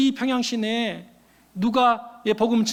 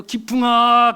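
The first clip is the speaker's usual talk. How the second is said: calling out; 6 dB louder, much slower than usual. Acted. Voice in dream calling name.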